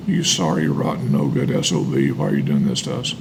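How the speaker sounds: ordinary talk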